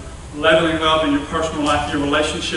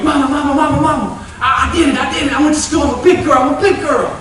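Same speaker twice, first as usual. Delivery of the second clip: put-on high, eager kid voice calling mama; a five-year-old bursting with pride; excited little girl; 8 semitones higher, 3 dB louder than usual. Cindy running to tell her mama she's a big girl, high and excited. Child Cindy shouting to mom.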